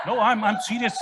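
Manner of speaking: laughing insistence. Real, not acted. Ambassador laughing while insisting the nomination is serious, laughing insistence.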